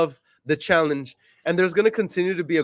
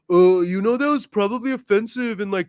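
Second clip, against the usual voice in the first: mock critic voice; 5 semitones higher than usual. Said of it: "people that are gonna be like".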